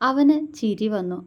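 ordinary delivery

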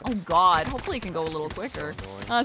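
ordinary talk, nothing marked

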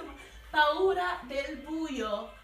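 usual speaking voice

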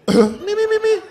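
rising me-me-me scale; vocal warm-up. Shown as a demonstration. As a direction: warm up the voice upward. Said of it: "rehearse your whole sick voice".

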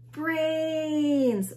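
high, loud zombie chorus; zombie moan. A narrator's reading. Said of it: "moaned the zombies".

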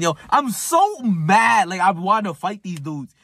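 high, loud, drawn-out venting; heated, emphatic outburst; worked-up anger. Retold or imitated. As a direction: relive being mad, stretch it out loud. Reliving anger at the opponent.

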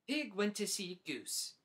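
ordinary delivery, nothing marked

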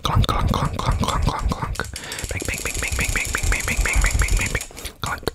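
whispered clunk and bang triggers; onomatopoeia triggers. Shown as a demonstration. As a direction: tap out the sounds in a whisper. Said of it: "mouth sound trigger words".

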